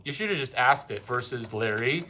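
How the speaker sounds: ordinary delivery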